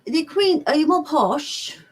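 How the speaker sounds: high, slow posh British accent; badly faked Queen's English; mock Queen's English. Shown as a demonstration. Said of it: "you speak the Queen's English".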